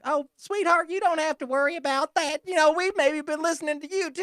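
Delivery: high-pitched mock-soothing woman; patronizing sweetheart voice. Diner assuring the storyteller they were listening too, patronizing high voice. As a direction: put on a high, sugary reassurance. Acts out diner reassuring the teller.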